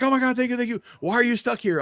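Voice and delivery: very high, gushing thank-you; imitated grateful woman. Woman thanking the stranger who helped, imitated gushing. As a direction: put on a high, effusive woman's voice.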